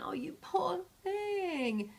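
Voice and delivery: drawn-out, syrupy fake sympathy; false concern. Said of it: "this false front and this false concern".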